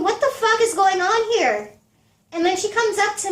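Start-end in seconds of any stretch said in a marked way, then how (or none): 0.0-1.7 raised, bewildered what-the-hell; thinking it in disbelief, pitched up; shocked inner voice, higher; stunned inner thought. Retold or imitated. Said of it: "I'm thinking".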